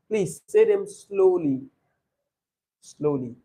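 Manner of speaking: drawn-out, measured instruction; slow, deliberate. Shown as a demonstration. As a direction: stretch the words out slowly. Teacher models slow speaking.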